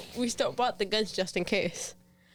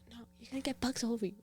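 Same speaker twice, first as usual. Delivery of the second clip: rushed warning; 3 semitones higher, 9 dB quieter, much faster than usual. Retold or imitated. Retold warning to brother.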